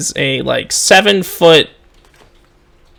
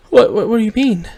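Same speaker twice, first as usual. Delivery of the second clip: high, quick stammering bewilderment; confused higher-pitched voice; 9 semitones higher, faster than usual. Acted. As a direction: trip over the first word, baffled. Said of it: "she looks confused".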